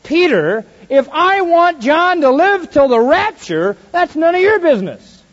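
firm loud rebuke. Acted. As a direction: drive it home with punch. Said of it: "repeat it a little bit with a little more punch".

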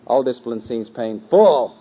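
painful with its final syllable -ful blasted loud; stress on last syllable. Shown as a demonstration. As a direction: playfully overdo the -ful in painful. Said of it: "by syllables".

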